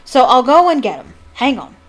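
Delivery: loud, bluff call of a big man; hearty call. A narrator's reading. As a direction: boom it out to the class. Hagrid calling to class.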